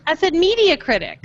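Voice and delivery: "media" hit high, correcting a mishearing; insistent correction, "media" stressed high. Real, not acted.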